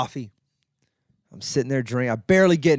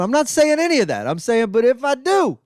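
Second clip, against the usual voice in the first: pitched way up, insistent; mock-dramatic protest; 9 semitones higher, 4 dB louder than usual. Pleading his case to Danny, high mock-dramatic protest. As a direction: build a hammed-up high protest. Acted rant at the manager.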